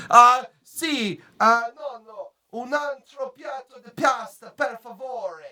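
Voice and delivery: high-pitched hammed-up Italian impression; mock Italian speakers. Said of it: "when they speak Italian, they say".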